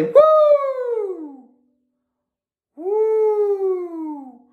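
long, high "woo" that glides down; sustained hooting woo, falling off at the end; high whoop. Shown as a demonstration. Violin teacher leads students in a loosening-up warm-up, high whoop. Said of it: "Now say".

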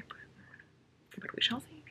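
whispered teasing aside; a soft whispered tease. Real, not acted. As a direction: drop to a secretive whisper. Trails off to a whisper.

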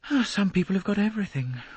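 bitter. A narrator's reading. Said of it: "said Rosalie bitterly".

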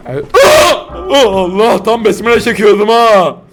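high shriek then loud gasping; startled scream. Real, not acted. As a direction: yelp as something leaps out. Jump scare.

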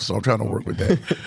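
normal talking voice

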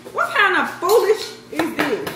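high, loud, fed-up cry; exasperated yell. Real, not acted. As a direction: throw the question up in real annoyance. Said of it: "I'm about to get mad".

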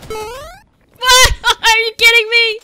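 high, loud disbelief; incredulous outburst. Real, not acted. Gamer hit by turrets, incredulous yell.

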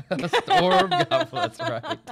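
high, loud laughing delivery. Real, not acted. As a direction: crack up while drawing the words out. Cracking up over the silly name.

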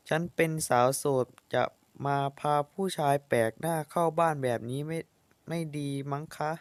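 usual speaking voice

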